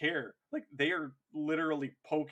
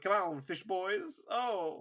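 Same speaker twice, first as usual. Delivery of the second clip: higher-pitched, drawn-out taunt; mock insult; 6 semitones higher, slower than usual. Ashen Claws taunting Carcharodon trade leaders, mocking. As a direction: goad them with a mocking jeer. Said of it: "just straight up insult them".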